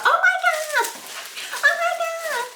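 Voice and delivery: very high, loud mock squeal; hammed-up shrieky family gushing; excited mom. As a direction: squeal it like a thrilled mother. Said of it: "And she'll be like".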